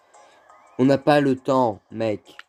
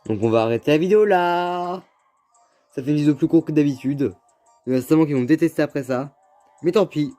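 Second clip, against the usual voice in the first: upbeat outro; 5 semitones higher, 4 dB louder than usual. Acted. Ending the video outro.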